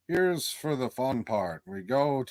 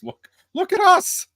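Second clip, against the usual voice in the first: much higher, louder squeal of mock pride; excited squeal; 10 dB louder than usual. Real, not acted. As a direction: point at yourselves, squealing. Mocking themselves as morons.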